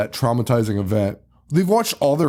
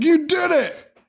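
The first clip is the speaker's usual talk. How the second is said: cheering; slower than usual. Retold or imitated. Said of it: "they're like".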